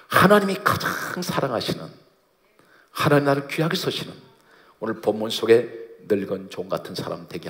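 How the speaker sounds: impassioned praying aloud; fervent prayer. Acted. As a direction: lift the voice in earnest appeal. Prayer builds to its petition.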